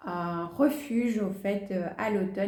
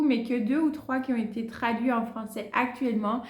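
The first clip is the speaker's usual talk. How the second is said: ordinary talk, nothing marked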